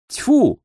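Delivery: high, short spitting sound; disgusted spit. A narrator's reading. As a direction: spit it out in vexation. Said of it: "сплюнул он".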